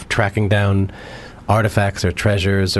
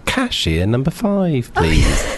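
slow, high announcer call; airport cashier announcer; 3 semitones higher, much slower than usual. Retold or imitated. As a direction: call the next customer like a bored cashier. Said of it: "the woman at the airport at the magazine that goes".

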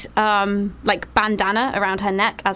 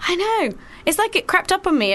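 ordinary delivery

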